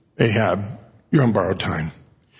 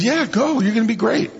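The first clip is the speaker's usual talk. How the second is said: much higher, sing-song fake encouragement; breezy sarcastic send-off; mock sarcastic; 10 semitones higher than usual. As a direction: cheer him on with open sarcasm. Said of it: "this is really strong sarcasm".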